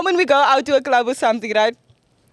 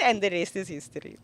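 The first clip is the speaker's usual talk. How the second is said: ordinary delivery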